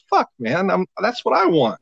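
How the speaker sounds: mock envious. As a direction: act out wanting to get fired too. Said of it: "And I'd be like".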